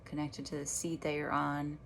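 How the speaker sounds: unhurried meditation guide with long pauses; guided meditation. Real, not acted. Meditation teacher guiding listeners back to their breath, guided meditation voice. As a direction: lead them gently through each breath, leaving space between phrases.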